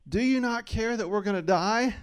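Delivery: mock the disciples while laughing; amused quote. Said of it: "Their first thing they said was".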